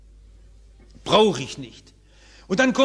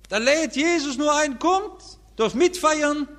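preaching at full force; 12 semitones higher than usual. Acted. Preacher voicing Jesus's invitation to the feast, fervent preaching. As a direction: call them in to the feast.

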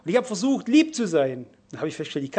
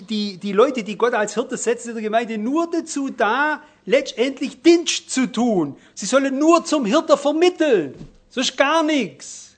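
higher, louder and slow, hammering the point; fervent preaching; 6 semitones higher, 5 dB louder, slower than usual. Acted. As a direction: preach it with rising urgency. Preacher building intensity.

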